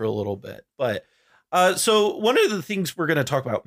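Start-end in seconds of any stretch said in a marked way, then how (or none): 1.5-3.6 voice cracking. Real, not acted. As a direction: voice cracks upward mid-sentence. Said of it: "my voice just cracked really bad there".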